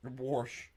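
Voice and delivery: "Warsh" with a heavy R before the sh; mock the word "Warsh" as "worsh"; imitated regional pronunciation. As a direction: lean on the R in "Warsh". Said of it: "when people say "warsh".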